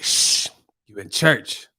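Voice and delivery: slow hushing old church lady, lightly voiced; raspy old lady. Old lady shushing Martin in church, lightly raspy. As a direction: shush slowly, a hint of rasp. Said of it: "that lady with that raspy voice".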